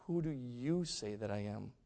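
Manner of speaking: slow, soft, low question; quiet probing. Retold or imitated. Jesus turns the question on Peter, quiet probing. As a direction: put it to them quietly and deliberately.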